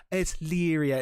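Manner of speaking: tearful Irish character. Retold or imitated. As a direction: imitate a weepy Irishwoman about to cry. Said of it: "she always sounds like she's going to cry".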